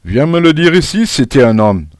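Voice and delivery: loud, defiant dare, starting high; defiant challenge. A narrator's reading. Pupil dares the bossy classmate to come over, defiant. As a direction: throw down a schoolyard challenge.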